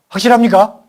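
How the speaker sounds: high, loud rallying question; loud challenge. Acted. Preacher presses the congregation for a firm answer, loudly. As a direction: call it out to the pews.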